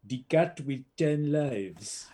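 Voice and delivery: slow, imitated Italian-accented title announcement; mock Italian voice-over, drawn out; Italian announcer. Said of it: "you've got this Italian guy".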